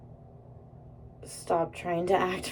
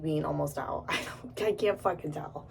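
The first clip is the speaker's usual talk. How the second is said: ordinary talk, nothing marked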